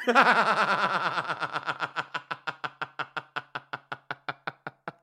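long machine-gun laugh, gradually dying away; staccato cackle. Real, not acted. Comic laughing at being called a stalker, staccato cackle.

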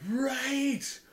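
gasps around a high "right!"; sudden realization. Real, not acted. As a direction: gasp as it suddenly clicks. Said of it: "I'm just connecting the dots now".

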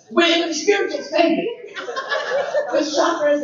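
laughing. Real, not acted. Laughing correction.

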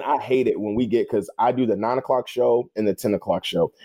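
usual speaking voice

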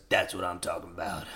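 low, soft ad-voice catchphrase; advert voiceover. Retold or imitated. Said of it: "this voice goes".

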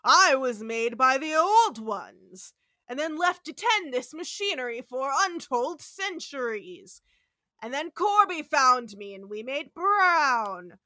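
loud, ponderous monster proclamation; Rock, ancient android. Retold or imitated. Ancient machine tells Kirk its origin, booming grandiose voice. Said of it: "And Rock is like".

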